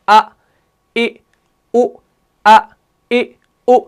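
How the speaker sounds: short separate "अ, ए, ओ", each starting from the throat; hamza vowel drill. Shown as a demonstration. Teacher showing how to read hamza, vowel drill. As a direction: sound "अ, ए, ओ" one at a time with crisp onsets. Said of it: "इसको आपने कैसे पढ़ना है".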